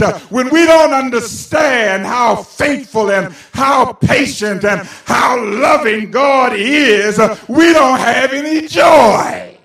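loud, slow, ringing sermon cry; shouting preacher, every phrase hammered slowly; preaching at full force. Acted. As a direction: drive it home to the congregation.

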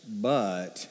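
"but" dropped low and soft, letdown; deflated but. Shown as a demonstration. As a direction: let the "but" sink, disappointed. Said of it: "that's like a".

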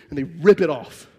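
usual speaking voice

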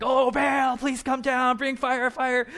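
high, loud hammed-up wail. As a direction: cry out to the god, overacting. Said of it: "you should say it louder".

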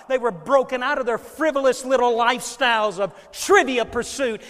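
loud, high, fervent sermon climax; preaching at full force. Acted. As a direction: preach it like thunder to the congregation. Preaching climax, retelling the search.

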